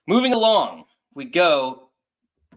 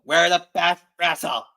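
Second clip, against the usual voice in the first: slow, louder mock Boston accent; 3 semitones higher, 8 dB louder than usual. Host imitates the wrestler's Boston-accented promo, slow and loud. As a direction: drag out wrestle like a Boston catchphrase. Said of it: "She says it weird with a weird, like, Boston accent".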